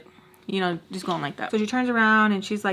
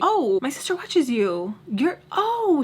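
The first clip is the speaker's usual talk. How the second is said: excited recognition; 5 semitones higher, 4 dB louder, slower than usual. Retold or imitated. Retelling a woman's excited recognition.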